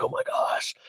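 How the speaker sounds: hushed mock amazement; retold voiceless gasp of wonder; whispered awe. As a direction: whisper it, acting awestruck. Retells awe at fancy hotel room.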